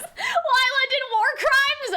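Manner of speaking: cracking up as you repeat it; laughing disbelief. Real, not acted. Laughing in disbelief at the Lila line.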